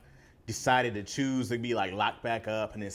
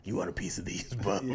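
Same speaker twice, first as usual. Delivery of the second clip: low, gruff tough-guy challenge; Wolverine; 5 semitones lower, 4 dB quieter than usual. Acted. Imitating a comic hero picking a fight, low and gruff. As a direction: growl it low and quieter. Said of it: "He has a gruff voice".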